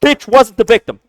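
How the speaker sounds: loud, scornful. Real, not acted. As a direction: spit it out loudly. Outraged rant continues.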